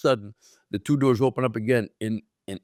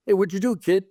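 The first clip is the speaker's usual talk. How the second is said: put-on higher-pitched needling of the kid; retold teasing question; 7 semitones higher than usual. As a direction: imitate a veteran ribbing a rookie. DiMaggio teases nervous rookie.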